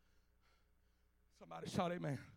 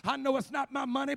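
ordinary talk, nothing marked

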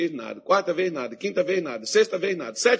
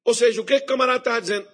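ordinary talk, nothing marked

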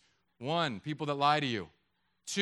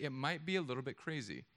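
usual speaking voice

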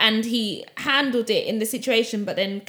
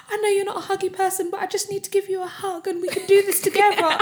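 mimicking her friend, higher; 9 semitones higher, 3 dB quieter than usual. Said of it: "You will be like".

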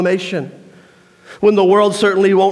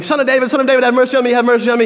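higher, pleading call; beggar calling out; 5 semitones higher, much faster than usual. Retold or imitated. Blind beggar calling to Jesus from the roadside, higher and pleading. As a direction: reach out to him over the crowd.

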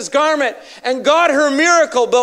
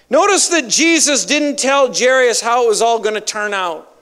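ordinary talk

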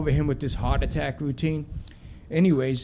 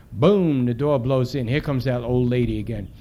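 plain reading voice

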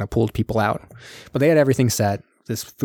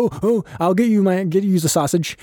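lightly hammed-up, higher-pitched retelling; mock eager shopkeeper; 8 semitones higher than usual. Butcher fussing over fetching sausage.

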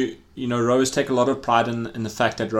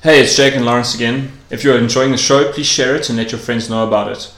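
loud, upbeat show plug; podcast outro read; 6 dB louder than usual. Acted. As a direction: announce it like a radio host.

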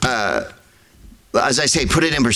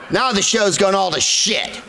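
ordinary talk

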